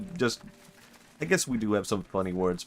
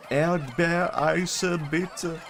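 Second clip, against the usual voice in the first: slow, higher-pitched practiced German; drawn-out German accent, pitched up; convincing German; 8 semitones higher, much slower than usual. Retold or imitated. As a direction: order carefully in a put-on German accent. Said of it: "practiced so hard to be a convincing German".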